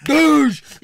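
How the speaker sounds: loud, high imitated headbutt impact; mock headbutt noise. Acting out the big man's headbutt, loud impact noise. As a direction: smash the sound out like a headbutt landing.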